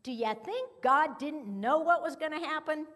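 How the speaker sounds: normal talking voice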